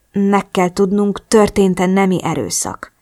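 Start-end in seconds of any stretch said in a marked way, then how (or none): none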